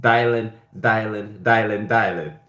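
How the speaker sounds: imitating a rapper's Jamaican accent. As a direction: say the name over and over in a mock Jamaican accent. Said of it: "with his Jamaican accent".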